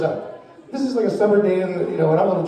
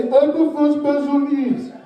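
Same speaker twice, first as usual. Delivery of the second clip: mocking voice; 6 semitones higher, slower than usual. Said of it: "If they say".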